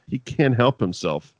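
usual speaking voice

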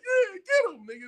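high-pitched egging on; emotional cheering. Retold or imitated. Little brother cheering the chase, emotional high cheer.

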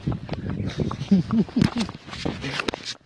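a long, airy laugh under the breath; breathy laugh. Real, not acted. Owner laughing at her scared dog, breathy laugh.

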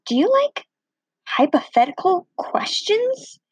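high, airy, half-whispered Bob; a breathy high voice for Bob's odd question. Retold or imitated. Bob asking if she likes hypothetical questions, high and breathy.

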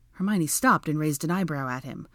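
ordinary delivery, nothing marked

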